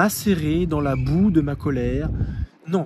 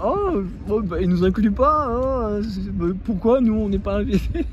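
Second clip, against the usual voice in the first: mock disgusted kids; 4 semitones higher, 4 dB louder than usual. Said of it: "Ils étaient un peu dégoûtés".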